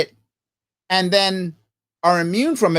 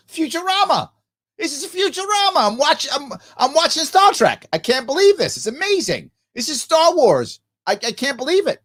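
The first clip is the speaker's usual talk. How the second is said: high, sarcastic gushing wonder; mock amazement; 8 semitones higher than usual. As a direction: fake awe, like seeing the future. Sarcastic fake amazement at trains.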